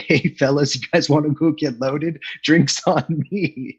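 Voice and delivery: a light hammed-up party buddy; mock bro voice. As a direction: lightly play a guy inviting the boys out. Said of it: "I just don't talk like that".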